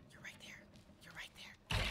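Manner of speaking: hushed creepy whisper; stalking a hidden survivor in a whisper. Real, not acted. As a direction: breathe it out while hunting.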